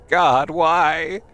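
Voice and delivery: loud, high wail to the heavens; anguished cry. Acted. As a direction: cry out in grief. Mother's grief over lost baby.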